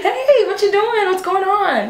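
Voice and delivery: very high, slow singsong hello; stretched-out sugary pitch, put on; fake-friendly greeting. Woman greeting her date from the car, overly sweet.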